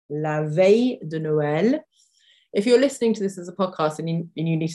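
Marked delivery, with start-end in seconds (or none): 0.1-1.8 La veille de Noël said slowly, each word clear; slow repeat. Shown as a demonstration. Teacher repeating the phrase slowly for students.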